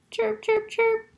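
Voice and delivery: high, short chirps; squeaking chicks. Shown as a demonstration. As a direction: imitate baby birds' squeaks. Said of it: "sounds like a real sound".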